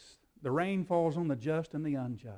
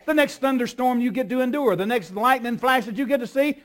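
full-force preaching; 9 semitones higher, 13 dB louder than usual. Acted. Preacher thundering the promise to the congregation, full force. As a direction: preach it out with rising fire.

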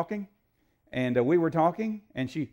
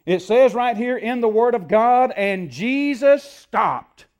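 loud, raised preacher's delivery; forceful preaching; 7 semitones higher, 9 dB louder than usual. Acted. Preacher urging somebody in the midst of a storm, forceful preaching. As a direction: preach it hard and urgently.

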